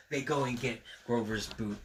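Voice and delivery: usual speaking voice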